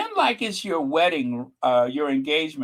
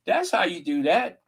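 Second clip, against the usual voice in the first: ordinary talk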